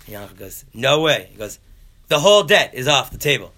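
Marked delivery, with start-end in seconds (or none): 0.7-1.3 high, loud acted rejection; loud flat-out refusal. Yankel refuses the offer. 2.1-3.5 high, loud haggling; acted-out exasperated concession; loud desperate offer. Desperate bargaining through the door.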